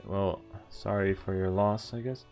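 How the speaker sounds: usual speaking voice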